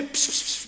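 soft unvoiced pss-pss to call a cat. Shown as a demonstration. Comedian showing the audience how to call a cat, soft pss sounds. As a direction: lure a cat with quiet hissed pss sounds. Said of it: "Als je een kat wil roepen".

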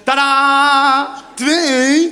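loud, sustained ta-da fanfare; big showman's announcement, held long; triumphant reveal. Acted. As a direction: present the surprise with showy pride. Presents two cats to girlfriend.

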